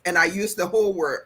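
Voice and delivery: usual speaking voice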